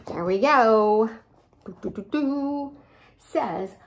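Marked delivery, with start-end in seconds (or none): none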